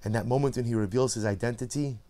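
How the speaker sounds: ordinary talk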